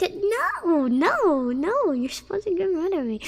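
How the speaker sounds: high, loud mock wail; acted protest. Streamer replays her objection to chat, mock whiny. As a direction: re-enact a playful, whiny objection. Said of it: "I was like".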